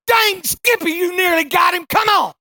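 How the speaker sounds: high, loud hollered praise; loud cowboy cheer; cheering shout. Retold or imitated. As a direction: whoop it up for your buddy. Buddy cheers the fallen rider.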